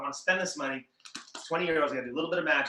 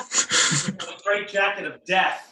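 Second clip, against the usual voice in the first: slow, louder, higher showman's announcement; mock-dramatic billing; 5 semitones higher, 6 dB louder, much slower than usual. Magician hamming up his straitjacket stunt, mock-dramatic. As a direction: draw it out like a deadly stunt title.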